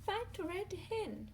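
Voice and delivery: high, lilting repeat of the phrase; sing-song echo. Real, not acted. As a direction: echo it high for a small listener. Echoes the rhyme's last words.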